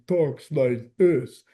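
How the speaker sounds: imitated slow movie-boxer voice; mock boxer impression; Rocky. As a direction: put on the boxer's dopey speech. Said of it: "Rocky does not have, like, a pure Philly accent".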